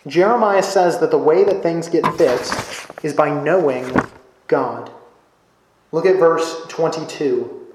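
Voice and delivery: normal talking voice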